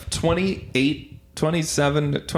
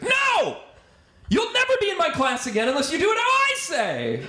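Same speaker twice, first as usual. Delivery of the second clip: high-pitched mock outburst; angry acting coach. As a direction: screech it like a tyrannical teacher laying down the law. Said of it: "And she's like".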